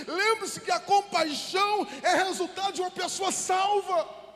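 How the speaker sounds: high, loud fervent preaching; impassioned pastor's appeal; preaching at full force. Acted. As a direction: drive the message home to the church. Sermon climax, high and loud.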